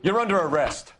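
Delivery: loud arrest call. Acted. Cop arrests suspect.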